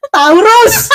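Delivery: high, loud laughing burst; laughing exclamation. Real, not acted. Answering a friend about the boyfriend's star sign, laughing shout. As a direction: blurt it out loudly, cracking up.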